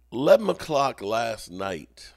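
usual speaking voice

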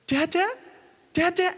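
very high, questioning child's call, imitated; little girl. Little girl to visiting men in the orphanage, very high questioning imitation. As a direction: pipe it up high like a small girl. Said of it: "a little girl over here".

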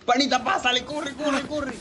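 high frantic yelling; panicked urging to run; being chased. Real, not acted. Fleeing Granny in game.

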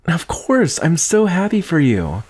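high, bright burst of joy for a friend; excited. Acted. Friend hears of promotion.

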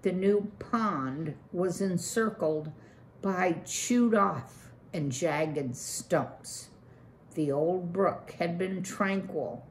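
ordinary delivery

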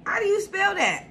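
high squeaky confusion; baffled. Retold or imitated. Reading [name]'s lyrics, high baffled question. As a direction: squeak it out puzzled. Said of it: "I'm like".

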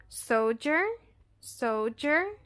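soldier with d as j; sol-jer, d as j; English model word. Shown as a demonstration. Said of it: "palavras que são escritas com D".

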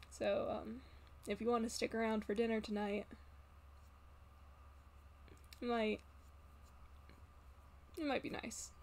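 softly spoken, hesitant character voice; Satoru. Acted. Satoru showing Sammy the adoption papers, quiet and hesitant. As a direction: trail through it quietly with halting pauses. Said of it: "Satoru, uh, goes".

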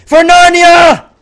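high, loud, slow heroic charge; mock epic war cry; battle cry. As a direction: bellow it like a charging knight. Throwing a random grenade.

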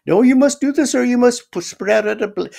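high, loud cut-off insisting; the caller insists he must do it, loud and high; insistent outburst. Retold or imitated. Caller insists, cutting him off.

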